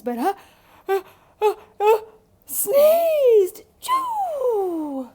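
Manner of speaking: stuttering uhs climbing to a loud high sneeze; building sneeze. Acted. Wolf after the chili powder, building up to a huge sneeze. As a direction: wind up and explode into the achoo.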